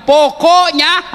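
high, slow, drawn-out "Pokoknya"; wife's stubborn line. Retold or imitated. Preacher mimics a stubborn wife, slow and drawn out. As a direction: stretch "Pokoknya" like a wife who won't budge. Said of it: "Apalagi kalau dia sudah bilang".

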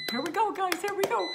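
high, loud here we go; excited. Real, not acted. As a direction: cheer it on, rising up. Dropping food in air fryer.

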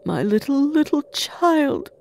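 mild, rising lament; grieving cry. A narrator's reading. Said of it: "cried Bob".

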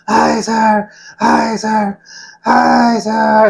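high, drawn-out recruit scream. Retold or imitated.

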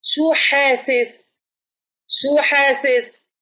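very slow, gently drawn-out question to a patient; slow caring question. Shown as a demonstration. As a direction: ask it slowly so the casualty follows each word. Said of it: "قول له".

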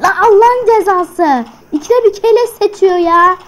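loud furious complaint; angry outburst. Real, not acted. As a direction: vent at the enemy. Angry at repeated deaths.

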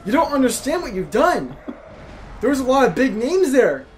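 high, loud hammed-up wail of a wounded pianist; mock panicked boss. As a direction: squeal it like a diva who broke her wrist.

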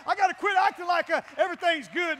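normal talking voice

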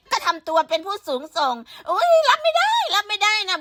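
mocking a prim lady's gasp; mock prissy. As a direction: fake fragile offense. Mocking prissy offended reaction.

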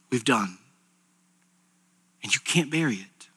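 low, soft preaching with long pauses; a preacher gone low and grave; hushed sermon. Acted. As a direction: lean in and confess it quietly. Hushed preaching on buried sin.

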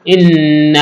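long nasal hum on the N of إن; গুন্নাহ. Shown as a demonstration. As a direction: hold the doubled N in إن through the nose. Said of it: "নুনে মিমে তাজদিদ গুণনা করা ওয়াজিব". (إن-এর নুনে টেনে গুন্নাহ)

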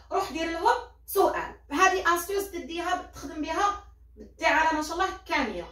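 ordinary talk, nothing marked

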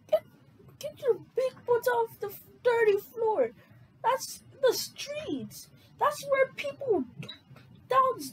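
high, fast squeaky scolding voice; a shrill put-on auntie scolding; high-pitched scolding. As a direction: squeal at him like a nagging auntie. Scolding from a script.

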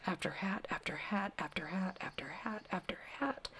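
soft wondering whisper. A narrator's reading. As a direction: hush it as the hats pile up. Endless hats, hushed wonder.

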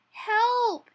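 high, loud, stretched yell; cry for help. A narrator's reading. Hedgehog skidding on the icy path, crying out. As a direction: yell it high as you fall. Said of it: "he cried".

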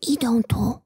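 barely moving lips, unvoiced; a frightened breathy hush; whisper. A narrator's reading. Gniewko warns hiding fugitives, lips barely moving whisper. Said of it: "szepnął Gniewko".

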